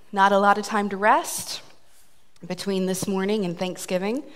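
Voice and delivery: ordinary talk, nothing marked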